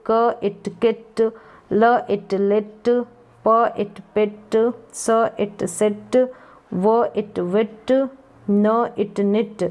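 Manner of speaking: slow, stretched sounding-out; drawn-out phonics. Shown as a demonstration. Teacher blending letter sounds for learners, drawn out. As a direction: sound out each part slowly, then blend. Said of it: "खींच के मैंने थोड़े long बता दिए हैं".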